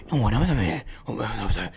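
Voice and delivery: low, garbled mumble; Gambit's garbled speech. Retold or imitated. Gambit talking to a baffled crowd, in an unintelligible mumble. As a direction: imitate unintelligible talk, deep and slurred. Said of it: "What the fuck is he saying".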